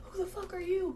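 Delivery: high, soft mock dog challenge; aggressive dog bark. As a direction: playfully voice a dog's challenge. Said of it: "it probably wasn't like a ... bark".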